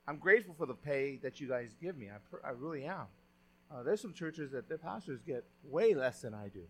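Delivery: ordinary delivery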